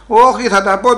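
loud, high refusal to be hushed; defiant outburst. Acted. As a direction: burst out over the warning, ending in a long cry.

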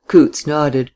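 plain reading voice

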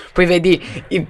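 ordinary delivery

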